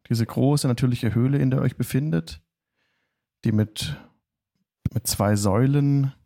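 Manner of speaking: ordinary delivery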